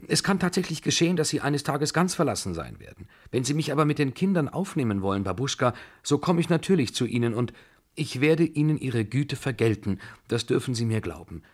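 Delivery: put-on eager young woman begging to keep the children; with warmth. A narrator's reading. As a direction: rush it out, heartfelt and urgent. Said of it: "fügte sie mit Wärme hinzu".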